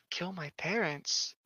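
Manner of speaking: soft and slow, a hint of a sinister voice; creepy hush. Playing the window telling her to kill, a creepy hush. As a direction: murmur it like a spooky whisper.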